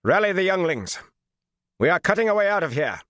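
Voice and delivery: high-pitched order on a level tone; flat voice. A narrator's reading. Commander orders his men to break out, flat voice. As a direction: give the order high, with little tune. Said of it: "he said in a flat voice".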